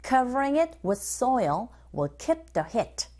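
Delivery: slow, careful drill reading; teacher modelling the phrase slowly; slow model line. Shown as a demonstration. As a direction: say it slowly for students to copy. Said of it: "One more time".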